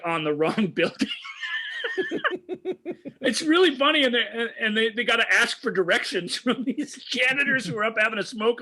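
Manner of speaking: laughing while talking, pitch climbing high; real laughter. Real, not acted. Film reviewer retelling a botched helicopter landing, laughing. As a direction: crack up while telling the story.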